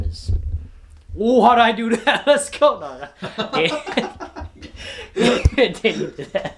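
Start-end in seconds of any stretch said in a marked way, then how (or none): 1.2-2.8 excited laughing yelp. Real, not acted. 3.4-6.5 laughing. Real, not acted.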